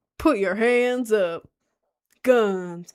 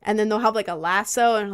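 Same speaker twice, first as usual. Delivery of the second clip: ordinary delivery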